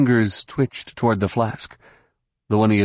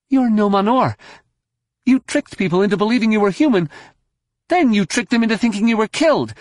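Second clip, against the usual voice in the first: high, fast accusation; urgent, high-pitched denunciation; accusing; 12 semitones higher, faster than usual. A narrator's reading. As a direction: fling the charge at him, pitched way up. Jaina accuses the unmasked villain.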